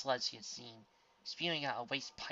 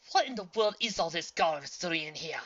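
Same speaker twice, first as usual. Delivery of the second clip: raised, louder outburst; sudden raised blurt of disgust; 5 semitones higher, 8 dB louder than usual. A narrator's reading. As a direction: blurt it in disbelief. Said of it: "spurted out".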